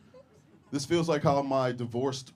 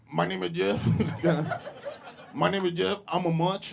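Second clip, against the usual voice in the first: higher, flattened laughing robotic voice; mock voice box; 7 semitones higher, 4 dB louder than usual. Comedian imagines post-cancer voice box, mock robotic. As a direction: fake electrolarynx buzz, laughing.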